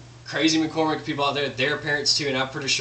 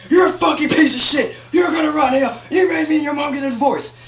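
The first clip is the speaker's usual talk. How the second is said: put-on much higher, much louder furious screaming at a child; abusive screaming; 12 semitones higher, 8 dB louder than usual. Shown as a demonstration. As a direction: scream it with raw rage at your kid. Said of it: "That's emotional abuse".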